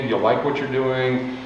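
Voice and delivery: ordinary talk, nothing marked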